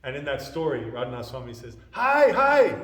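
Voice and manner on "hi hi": loud, high-pitched cry of alas; alarmed lament. Retold or imitated. Brahmana discovers the toddler in the rice, dismayed cry. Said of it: "Hi means in, in this, right? "Alas".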